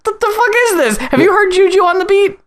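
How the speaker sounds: high, fast disbelieving squeal; baffled disbelief. Retold or imitated. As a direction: react like you just heard the strangest song ever. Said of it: "and I was just like".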